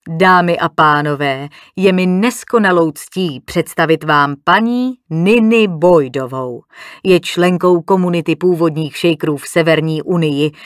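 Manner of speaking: loud, formal presentation to guests; host's introduction. A narrator's reading. Host presents Mrs. Boydová to the diners, formal announcement. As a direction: introduce the honoured guest to the whole room.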